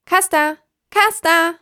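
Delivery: hoch und laut fröhlich rufen; fröhlicher Ruf. Acted. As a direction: froh rufen, um ihn zu wecken. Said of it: "rief Kraja fröhlich". (fröhlich rufende Krähe)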